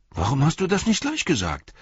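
put-on high, startled boyish question; dismayed. A narrator's reading. Boy dismayed Pippin didn't say so sooner. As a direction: caught out and dismayed. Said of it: "mit bestürzter Miene".

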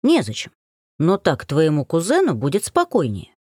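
plain reading voice

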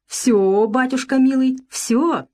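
сладко, певуче, выше; ласково пропеть; сдобный голос. A narrator's reading. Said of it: "пела бабочка сдобным голосом". (сдобным голосом)

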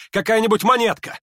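high, loud, eager question; agitated high-pitched plea; urgent demand. A narrator's reading. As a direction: press them for it excitedly. Hippolyte urgently demands a coin.

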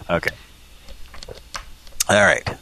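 ordinary delivery

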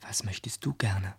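hushed whisper; secretive whisper between kids. Acted. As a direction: breathe it, no voice. Whispers throughout.

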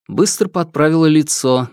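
plain reading voice

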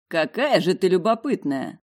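lightly mocking, sarcastic. A narrator's reading. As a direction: tease her, a hint of sarcasm. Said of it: "насмешливо-язвительное".

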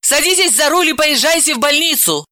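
high, urgent command; irritated, insistent woman; getting angry. Acted. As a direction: order him about crossly. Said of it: "рассердилась Далила".

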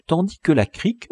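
usual speaking voice

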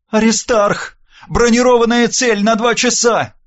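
high-pitched urgent radio alert; woman's voice bursting in with a warning. A narrator's reading. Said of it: "Голос Ольги ворвался в мои уши".

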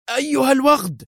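very high-pitched furious exclamation; angry cry. A narrator's reading. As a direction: hurl the insult at a high pitch. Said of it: "وهو يهتف في غضب". (هتاف غاضب)

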